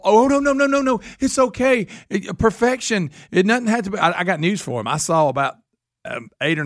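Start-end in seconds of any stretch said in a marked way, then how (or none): 0.0-4.0 high, syrupy mock-soothing coo; mocking the judges. Mocking judges soothing a crying contestant, high mock coo. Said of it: "And the judges fall all".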